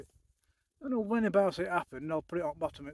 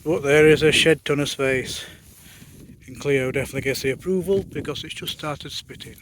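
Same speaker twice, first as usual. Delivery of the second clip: loud, raised voice against the wind; shouting over wind; 9 dB louder than usual. Real, not acted. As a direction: project loudly over a gale. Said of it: "hopefully you can hear me over the wind".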